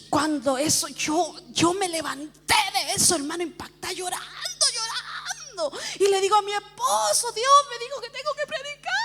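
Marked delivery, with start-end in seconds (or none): 3.9-5.8 drawn-out, high, soft wail; mock wailing. Preacher telling how she rose crying, mock wail. Acts out her weeping. 7.3-8.9 very high, tearful announcement; re-enacted frantic crying. Preacher telling her husband the news, frantic tearful cry. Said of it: "yo estaba como loca".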